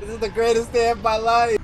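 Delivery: high loud joyful yell; ecstatic shout. Real, not acted. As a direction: shout it in triumph. Celebrating victory.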